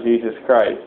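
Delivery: ordinary delivery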